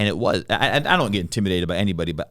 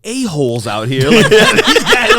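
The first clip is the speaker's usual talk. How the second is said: laughing hard, pitch jumping much higher and louder; 11 dB louder than usual. Real, not acted. Telling the couple he thought they were mean, laughing hard. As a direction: crack up mid-sentence.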